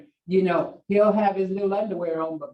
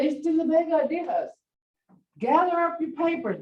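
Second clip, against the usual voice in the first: pitched up, a hint of nagging; lightly voiced bossy grandma; mild nagging grandmother; 8 semitones higher than usual. Grandma ordering grandson to pack.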